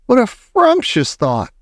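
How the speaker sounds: fawning witch audience; adoring witches. Acted. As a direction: gush over her genius. Witches delight.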